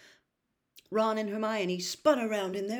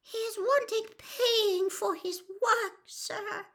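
slow high squeak, slightly lowered; Winky, confiding; slower than usual. A narrator's reading. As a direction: confide it slowly as a squeaky elf. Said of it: "lowered her voice by a half octave and whispered".

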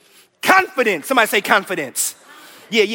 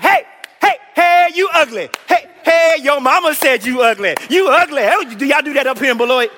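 high, loud sing-song taunts; mock a cheerleader yelling insults; taunting cheerleaders; 4 semitones higher, 3 dB louder than usual. Cheerleader taunting a kid, high and loud. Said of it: "They, they were like".

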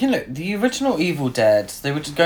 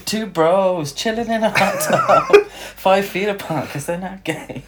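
laughing; 4 semitones higher than usual. Real, not acted. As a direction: laugh through the joke. Laughing through his joke.

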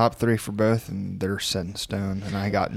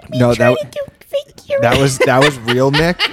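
laughing; 4 semitones higher, 9 dB louder, slower than usual. Real, not acted. Protesting through laughter.

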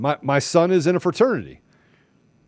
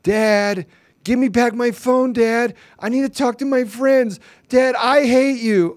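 put-on high, whiny teenager voice, much higher; whiny teen; 10 semitones higher, 3 dB louder, slower than usual. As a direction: whine at your dad like a teen. Voicing whining teen.

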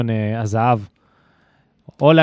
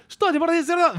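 higher, mock cleaner's scolding; mock Russian scolding; faster than usual. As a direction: nag him like the second cleaner. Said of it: "היא צעקה עליי".

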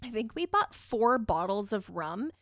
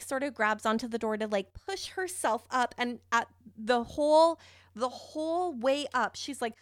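normal talking voice

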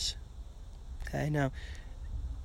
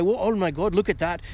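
lightly voiced Scottish pub onlooker, pitched up; Scottish accent; 3 semitones higher, 4 dB louder than usual. Lecturer mimics a Scottish guy watching in the pub, Scottish accent. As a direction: exclaim in a rough attempt at a Scottish accent. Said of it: "then there's this Scottish guy".

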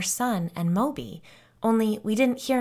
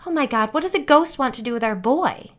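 panicked; 6 semitones higher, 7 dB louder than usual. Acted. Frightened mother in narrated story.

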